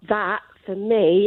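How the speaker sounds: normal talking voice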